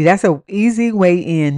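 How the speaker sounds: ordinary talk, nothing marked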